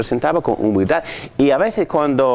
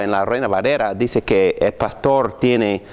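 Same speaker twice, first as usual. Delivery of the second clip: normal talking voice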